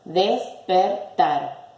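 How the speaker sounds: slow, separated "Despertar"; split into syllables. Shown as a demonstration. Teacher separating the word for pupils, syllable by syllable. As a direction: say "Despertar" as des, per, tar with gaps. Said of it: "La separo en sílabas".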